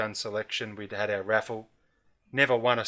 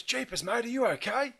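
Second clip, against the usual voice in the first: higher, startled "Jeepers, mate" of a concerned local; worried country bloke; 12 semitones higher than usual. Retold or imitated. Homeowner checking on the crashed driver, higher and alarmed. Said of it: "and he goes".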